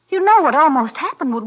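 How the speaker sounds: ordinary talk, nothing marked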